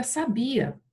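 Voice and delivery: "sabia" with BI strong, final A weak; stress on BI. Shown as a demonstration. Teacher showing what a missing accent does, stressed on BI. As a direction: say "sabia" as saBIa, dropping after BI. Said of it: "Se eu não colocar o acento".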